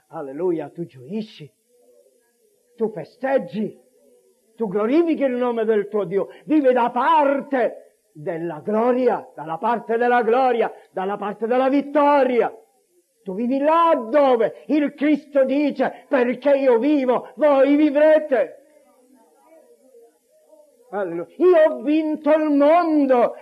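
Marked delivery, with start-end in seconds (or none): 2.7-18.6 fervent preaching. Real, not acted. Preacher telling believers to live in victory, fervent and ringing. 21.4-23.3 high, loud declaration of victory; triumphant proclamation. Acted. Preacher quoting Christ who overcame the world, triumphant.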